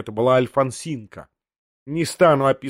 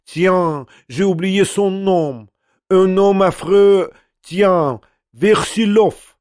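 put-on higher, slow, French-accented maid; frantic French maid, higher and slow; French chatter; 5 semitones higher, 4 dB louder, much slower than usual. A narrator's reading. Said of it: "с плачем раскаяния и с неистовыми жестами она затрещала".

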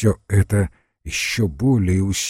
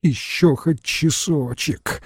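slow, higher moaning plea; 5 semitones higher, 4 dB quieter, slower than usual. A narrator's reading. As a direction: moan the plea, drawn out. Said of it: "простонала бригадирша".

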